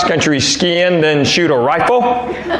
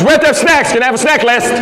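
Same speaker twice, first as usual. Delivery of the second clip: high-pitched, pushy demand; high, demanding team-parent voice; insistent parent; 7 semitones higher, faster than usual. Retold or imitated. Acting an insistent snack-demanding parent.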